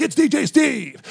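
high, excited DJ shout-out; hyped DJ. Retold or imitated. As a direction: announce yourself like a hyped DJ. Imitates DJ announcing himself.

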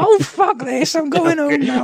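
a fed-up king in a silly high voice; Henry the Fourth voice. Pretend. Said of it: "the same Henry the Fourth voice".

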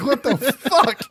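high, drawn-out incredulous outburst; exasperated, high-pitched and slow; mock disbelief. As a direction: stretch it out in comic bafflement. Incredulous reaction to the retold scene.

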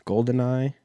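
usual speaking voice

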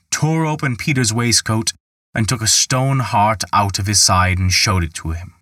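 usual speaking voice

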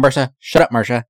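loud snapping command; angry bossy shout. Retold or imitated. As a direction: snap at Marsha loudly. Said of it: "He's like".

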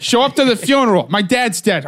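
getting in character. Acted. Pitching his movie, a hammed-up grieving son. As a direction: play the grieving brother as a mock movie scene. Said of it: "Let me get in character".

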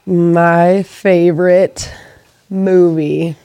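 drawn-out, teasing question; slow dramatic question. Shown as a demonstration. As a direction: stretch it out for suspense. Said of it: "quiz show pacing".

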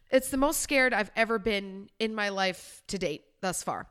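ordinary delivery

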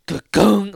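imitated sound effect. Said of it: "when he just, like".

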